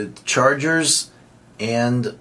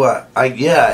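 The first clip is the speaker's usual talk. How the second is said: ordinary talk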